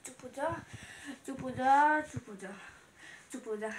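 put-on low, very soft baby-hushing murmur; soft soothing hush. Mother calming her crying baby, soothing and hushed.

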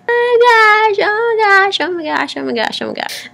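high panicked squeal, fading down. Real, not acted. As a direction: squeal it high, then calm down. Panicking about the kiss.

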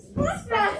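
high, bright coaxing; coaxing call. Real, not acted. Coaching child to scrunch.

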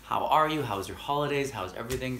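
a light imitation of a thrilled saleswoman; gushing questions. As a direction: pour out questions brightly, excited to reconnect.

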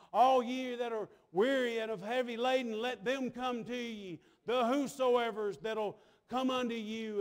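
steady, driving pulpit delivery; impassioned sermon cadence; preaching. Real, not acted. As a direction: preach it out to the congregation. Preaches with force.

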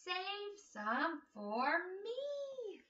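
slow, drawn-out cry; slow farmer pleading for apples; farmer's plea. Acted. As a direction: stretch it out pleading. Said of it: "cried the farmer".

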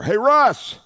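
high, loud call across a room; big drawn-out shout of a name; hollering. Retold or imitated. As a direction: holler to catch a friend's attention. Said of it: "and I holler".

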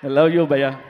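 a big, public declaration of love; loud affectionate call. Real, not acted. Affection shouted to friend Ali. (ప్రేమగా గట్టిగా అరుస్తూ)

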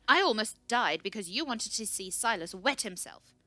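loud, heated retort; hurled back. A narrator's reading. Researcher's calm shatters at the scout, hurling it back. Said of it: "She hurled back".

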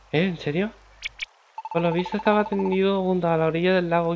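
a hint of an excited kid. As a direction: call out like an eager child. Friend character calling excitedly.

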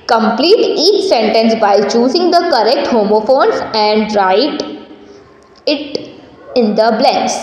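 slow, measured dictation-like reading; textbook read-aloud. Acted. Teacher reading the fill-in-the-blank instruction to the class, slow read-aloud. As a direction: read slowly with pauses between phrases.

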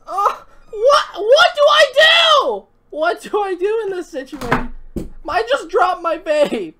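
high, loud, near-shrieking panic; panicked cry. Real, not acted. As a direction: cry out in alarm after dropping him. Panicking after dropping the cat.

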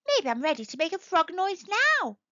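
high, eager voice; excited tadpole. A narrator's reading. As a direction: ask it with growing hope. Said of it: "he thought excitedly".

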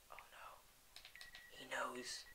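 hushed whisper, holding breath; tense hushed whisper. Real, not acted. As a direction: whisper so the mob won't hear. Sneaking past a mob.

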